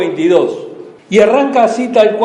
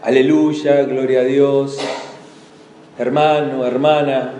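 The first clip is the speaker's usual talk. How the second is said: stock church phrases; 6 semitones lower, 3 dB quieter than usual. Retold or imitated. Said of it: "¿Qué digo con latiguillos?".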